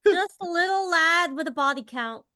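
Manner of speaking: ordinary talk, nothing marked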